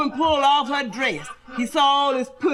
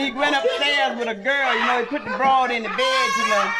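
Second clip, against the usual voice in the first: ordinary talk, nothing marked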